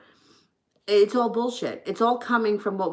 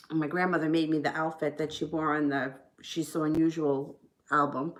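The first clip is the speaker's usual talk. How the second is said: ordinary talk, nothing marked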